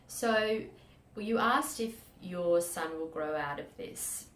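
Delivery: usual speaking voice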